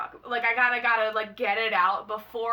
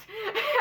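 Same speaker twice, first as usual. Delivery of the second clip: giggle; 10 semitones higher than usual. Real, not acted. Friend in love with Wyatt.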